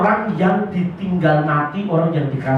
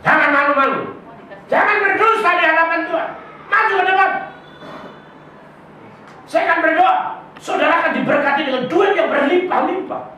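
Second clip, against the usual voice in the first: mock prosperity preacher; 8 semitones higher, faster than usual. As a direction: lure the crowd forward like a greedy preacher. Mocking a money-prayer preacher.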